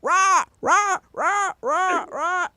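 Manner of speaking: high, squawking parrot "rah" calls. Pretend. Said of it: "And I go".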